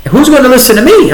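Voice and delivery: replaying the doubt about going on air, now exclaimed; incredulous self-doubt. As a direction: throw the question up like it's ridiculous. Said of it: "I thought to myself".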